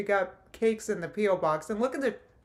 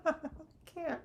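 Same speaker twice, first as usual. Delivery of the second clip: squeaky, drawn-out laughter through the words; real helpless laughter, slow on the last words; laughing fit; 8 semitones higher, slower than usual. Real, not acted. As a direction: laugh until the words barely come. Laughing helplessly at fruit snacks.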